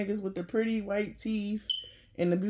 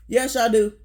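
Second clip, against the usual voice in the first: louder, emphatic yes y'all do, pressing the point; insistent yes; 7 dB louder than usual. Real, not acted. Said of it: "Yes, the fuck y'all do".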